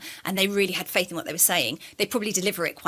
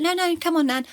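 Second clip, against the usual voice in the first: coaxing nan; 9 semitones higher than usual. Retold or imitated. Coaxing reluctant nan into bath.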